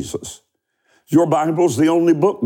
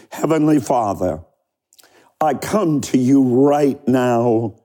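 spaced-out prayer lines for the crowd to repeat; leading prayer. Acted. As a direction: pray it line by line, pausing for echoes. Leads the sinner's prayer.

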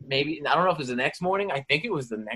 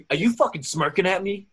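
mock confrontation; 6 semitones higher than usual. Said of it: "I go".

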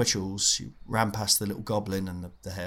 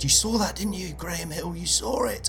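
put-on much higher, whiny kid voice; pleading nerdy boy; 10 semitones higher than usual. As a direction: squeak it out like a desperate nerd. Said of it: "he turned round to Graham Hill and he said".

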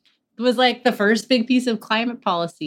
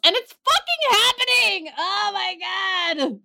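alarmed outburst; 12 semitones higher than usual. Real, not acted. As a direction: wail it in exaggerated horror. Alarmed outburst about zombie viruses.

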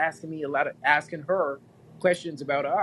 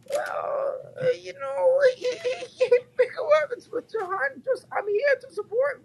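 hammed-up crying wail, much higher, fast; mock wailing wife. Wife confessing the ex's visit, mock wailing falsetto.

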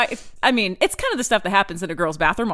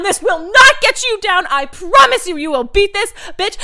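yelling; 6 semitones higher, 6 dB louder than usual. Retold or imitated. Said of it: "she just keeps yelling".

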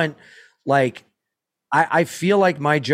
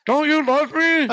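high, pleading trapped husband; desperate husband; 11 semitones higher, slower than usual. Pretend. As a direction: beg for help as the crushed husband. Pleading husband trapped under rubble.

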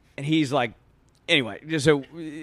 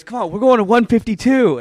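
raised, loud voice imitating an insistent friend; rally them along, high and loud; retold urging call; 8 semitones higher, 6 dB louder than usual. Willie urges him along, retold.

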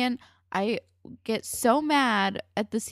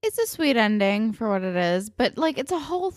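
usual speaking voice

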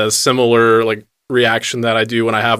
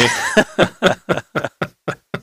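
airy giggle, pitched up; breathy high laugh; 8 semitones higher than usual. Real, not acted. Laughs at own joke.

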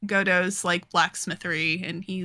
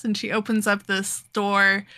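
normal talking voice

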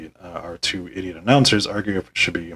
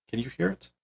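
a soft, higher, fed-up question; deadpan despair; 6 semitones higher, 10 dB quieter than usual. Real, not acted. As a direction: ask it like you've given up. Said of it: "you can hear it in my voice".

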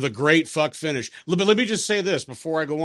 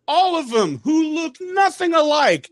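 incredulous; 13 semitones higher, 4 dB louder than usual. Real, not acted. Incredulous rant peak.

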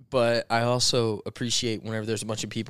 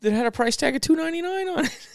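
incredulous. Real, not acted. Amazed at free comic price.

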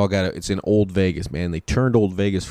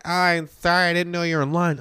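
apologetic woman; 8 semitones higher than usual. Retold or imitated. Woman apologizing in retold scene.

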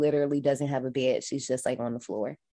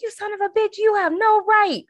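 mock outraged preacher; 3 dB louder than usual. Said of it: "He was like".

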